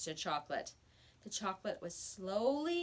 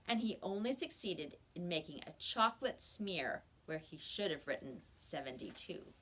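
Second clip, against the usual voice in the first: usual speaking voice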